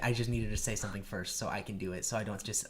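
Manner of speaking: ordinary delivery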